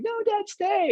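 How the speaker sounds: very high, pleading child imitation; upset kids. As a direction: mimic a child begging in a squeaky high voice. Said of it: "the kids would be all upset".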